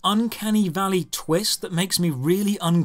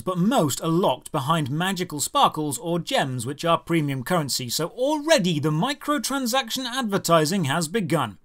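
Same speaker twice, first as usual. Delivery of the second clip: ordinary talk, nothing marked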